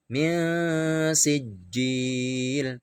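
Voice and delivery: min sijil very slowly, nun hummed two beats; ikhfa, very slow. Shown as a demonstration. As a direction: stretch the nasal hum of min before sijil. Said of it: "tidak boleh dibaca jelas". (min sijil sangat pelan, dengung dua ketuk)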